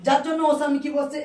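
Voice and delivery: ordinary talk, nothing marked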